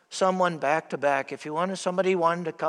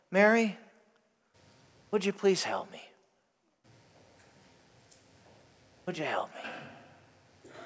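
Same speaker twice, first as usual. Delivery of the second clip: soft, fading ask, slowing to a near hush; calm gentle request; 8 dB quieter than usual. Retold or imitated. Martha calmly asking Mary for help, soft and gentle. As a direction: ask kindly, letting it fall quiet. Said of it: "calmed down".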